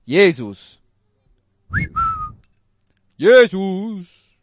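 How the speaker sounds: loud, low, desperate cry of "Jesus"; shouting out. Shown as a demonstration. Preacher demonstrates the blind man's cry, shouting. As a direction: call out "Jesus" with full force. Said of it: "es bedeutet schon schreien".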